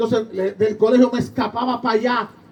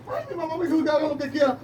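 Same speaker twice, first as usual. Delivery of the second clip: mock crying daughter; 3 semitones higher, 7 dB quieter, faster than usual. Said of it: "y viene ella llorando".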